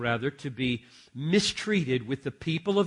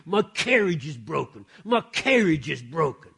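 complaining man; 2 semitones higher, 8 dB louder than usual. Retold or imitated. Preacher imitating the man walking to his inheritance, loud grumble. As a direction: whine it like a man wronged by fate. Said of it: "saying all the way".

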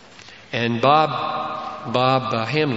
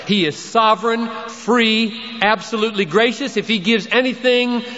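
full-force preaching; 9 semitones higher than usual. Acted. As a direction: hammer home that grace cannot be earned.

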